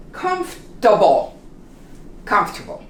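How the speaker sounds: Comfortable said as comf-ta-ble; three syllables. Shown as a demonstration. Teacher showing the reduced form. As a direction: drop the middle vowel in Comfortable. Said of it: "This really disappears".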